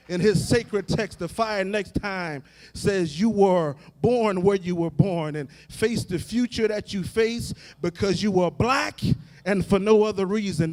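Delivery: usual speaking voice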